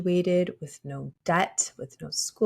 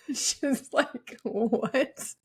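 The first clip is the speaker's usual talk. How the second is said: laughing; 6 semitones higher, much slower than usual. Retold or imitated. Friend's puzzled reply, laughing.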